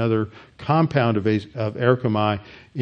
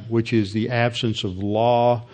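ordinary talk, nothing marked

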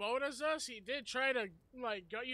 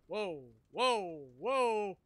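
alarmed whoa; 2 semitones lower, 4 dB louder, much slower than usual. Real, not acted. Alarm during a fight.

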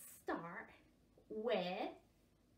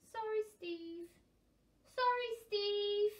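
higher, slow apologetic puffins; sheepish sorry; 9 semitones higher, 8 dB louder, much slower than usual. Acted. Puffins making up, apologising gently. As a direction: say sorry meekly.

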